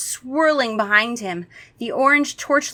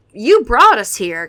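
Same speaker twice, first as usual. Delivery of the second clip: loud, slow indignant protest; loud accusing protest; angry protest; 3 semitones higher, 10 dB louder, slower than usual. A narrator's reading. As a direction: protest it angrily, hitting it hard. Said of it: "Kara protested angrily".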